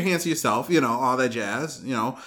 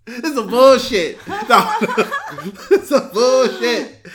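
laughing mock outrage, higher and louder; 8 semitones higher, 6 dB louder, slower than usual. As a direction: cackle out the complaint.

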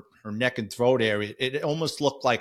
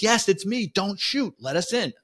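higher, pleading call that settles down; urgent reply; 8 semitones higher than usual. Retold or imitated. Trapped cop answering his partner through the door, high pleading call. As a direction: reassure them it's you, still urgent.

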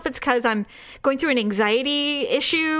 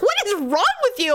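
mock exasperation; 9 semitones higher than usual. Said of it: "He's like".

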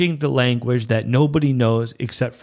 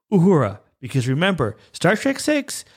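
ordinary delivery

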